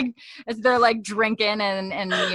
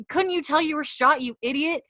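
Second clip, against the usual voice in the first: high-pitched mock scolding; acted indignant reproach, voice raised in pitch; 8 semitones higher than usual. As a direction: tell him off in exasperation. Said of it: "she's already scolding him".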